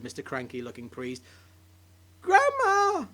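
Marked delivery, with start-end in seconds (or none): 2.2-3.1 high, loud call to grandma. Acted. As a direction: call her across the yard. Calling out to grandma.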